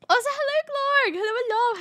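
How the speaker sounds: high, loud cheerful hellos; bright high greeting. Real, not acted. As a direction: sing out a hello to a viewer. Greets a chat member.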